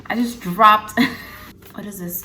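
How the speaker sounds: loud burst breaking into a laugh; flustered laugh. Real, not acted. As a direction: blurt it loudly, then laugh. Drops something while recording.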